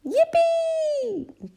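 very high, drawn-out squeal of joy; Peppa's excited cry. A narrator's reading. Peppa as the car sets off, squealing happily. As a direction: cheer at the top of a little girl's voice. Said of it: "cried Peppa".

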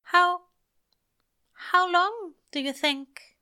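high, halting, careful question; delicate. Acted. Angel delicately asking Crowley how long, high and halting. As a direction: tiptoe into the question. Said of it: "he started delicately".

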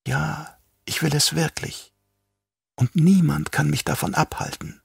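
soft, quietly fanatic Yui; lightly voiced traitor doctor. A narrator's reading. Yui confesses his urge to kill.

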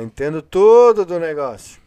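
loud, ironic boast; mock your own ignorance; sarcastic. Real, not acted. Ironic self-mockery about games.

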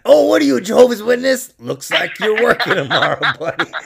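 hammed-up gleeful boss; laughing mock manager.

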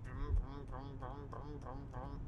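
soft humming phone buzz; phone buzz imitation. Complaining about a flood of texts, a soft hummed phone buzz. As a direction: hum the buzzing phone quietly. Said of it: "when my phone's like".

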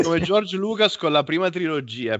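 high, exasperated rant; mock outburst of anger. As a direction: play up a fit of rage. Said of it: "posso anche permettermi uno scatto di ira".

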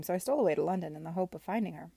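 fast, tumbling rush of words; talking too fast. Acted. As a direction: hurry through it, words spilling out nervously. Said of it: "She was talking too fast".

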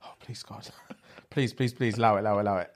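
hushed, breathy pleading; whispered begging, sinking low; panicked breathing. Retold or imitated. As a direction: pray desperately under your breath. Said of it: "I'm breathing like".

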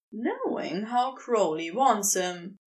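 ordinary delivery

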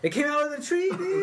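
high, loud disbelief; excited. Real, not acted. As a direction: tell it like it just happened. Excited retelling of squirrel attack.

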